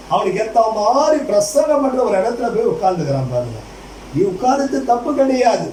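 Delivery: ordinary talk, nothing marked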